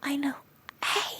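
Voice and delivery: usual speaking voice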